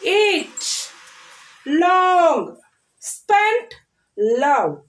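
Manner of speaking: each word drawn out and set apart; saying words aloud. Shown as a demonstration. Teacher showing children how to say it aloud, word drill. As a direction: call out each word for repeating. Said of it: "You should listen and say it aloud".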